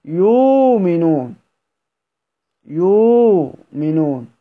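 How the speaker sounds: يؤمنون read slowly as yūminūn, the hamza replaced by a long drawn u; Warsh reading with long waw. Shown as a demonstration. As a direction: draw out يؤمنون in slow recitation with no glottal stop, long u at the start. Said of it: "يبقى ببدلها يقول".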